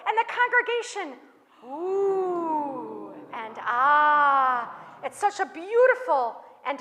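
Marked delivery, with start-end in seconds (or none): 1.7-4.8 crowd oohing. Acted. Congregation admiring the Torah.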